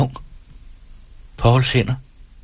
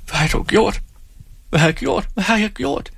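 high, repeated question climbing higher; rising horror; 10 semitones higher than usual. Acted. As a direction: panic mounting with each repetition. Horrified at what she did.

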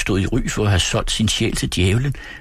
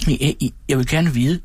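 put-on higher, stammering timid boy; trembling; 5 semitones higher than usual. A narrator's reading. Frightened boy answers the old shopkeeper, trembling. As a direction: falter nervously on the first words. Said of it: "svarede jeg skælvende".

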